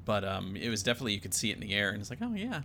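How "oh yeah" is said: ordinary delivery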